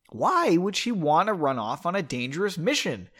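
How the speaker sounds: comically fed-up protest; exasperated rant. Acted. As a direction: build from bafflement to a high outburst.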